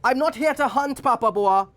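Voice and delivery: narrated frightened protest; screamed plea. A narrator's reading. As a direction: call it out high to the forest. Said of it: "Titi screamed".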